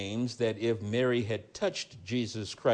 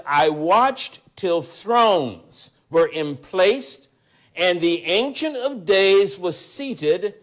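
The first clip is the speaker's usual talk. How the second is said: raised, ringing Bible reading; scripture reading; 4 semitones higher, 6 dB louder, slower than usual. Acted. Preacher reading the resurrection story aloud, raised and ringing. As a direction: proclaim the gospel passage to the congregation.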